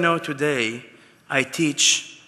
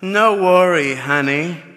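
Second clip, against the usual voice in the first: light mock Italian accent, louder; 4 semitones higher, 7 dB louder than usual. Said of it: "in Italian accent, she said".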